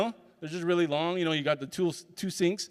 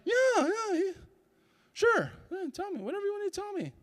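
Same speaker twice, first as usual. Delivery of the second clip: much higher, eager yes-yes-yes; 13 semitones higher, 4 dB quieter than usual. Retold or imitated. Answering the old lady who wants to tell him something, high and eager. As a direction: gush with anticipation, pitched way up.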